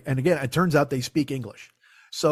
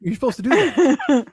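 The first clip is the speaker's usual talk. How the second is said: louder, drawn-out petty retort; slowly, louder, as if scolding the cashier; mock indignant; 11 semitones higher, 7 dB louder than usual. Imagined customer scolding a cashier, slow and loud.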